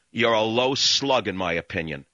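normal talking voice

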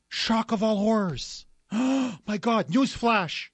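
hammed-up sarcastic horror, slow; mock shock. Radio host mocking liberals, sarcastic mock horror. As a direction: gasp out the fake outrage.

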